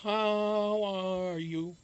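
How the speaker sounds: very slow, sweet how are you; nice slow version. Shown as a demonstration. Singing the nice version of the song slowly to chat. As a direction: stretch it out gently like a lullaby. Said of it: "why not make a nice version of it".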